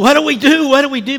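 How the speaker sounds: high, loud panicked cry; frantic, pleading for help; panicked young man. Retold or imitated. Young man on the floor begging the preacher, panicked high cry.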